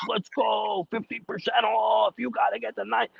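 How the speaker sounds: high-pitched mock hype, drawn out; imitating Jerry's yelling. Host mimicking Jerry hollering in his face at bowling, high and hyped. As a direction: ham up a rowdy friend cheering you on. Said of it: "I got him screaming that in my face".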